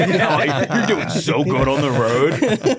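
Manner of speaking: high, very slow, laughing sarcasm; drawn-out fake praise through a laugh; sarcastic. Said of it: "the sarcastic".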